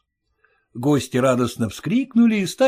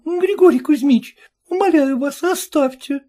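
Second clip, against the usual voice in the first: pleading bride; 13 semitones higher, 3 dB louder than usual. Acted. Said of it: "шепчет новобрачная".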